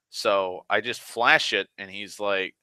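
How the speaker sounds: ordinary delivery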